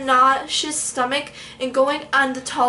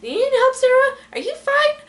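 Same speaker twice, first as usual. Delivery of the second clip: high, sing-song fake concern as the ride attendant; mock caring operator; 12 semitones higher, 3 dB louder than usual. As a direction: mimic a sweet worker checking on a scared rider. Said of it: "be like".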